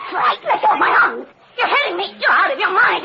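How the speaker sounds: high, loud panic while held; frightened struggle. Acted. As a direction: fight off the grip, terrified. Emily grabs Daisy's arms.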